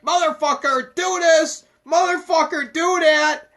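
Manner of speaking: a dictator yelling commands; dictator king. Retold or imitated. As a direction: bark it like a tyrant king. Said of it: "he's a dictator".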